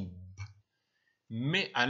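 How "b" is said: B as a short "b" noise; bare letter sound. Shown as a demonstration. Teacher correcting letter to sound, B as bare "b". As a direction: give B its sound, not its name "bé". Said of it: "le son".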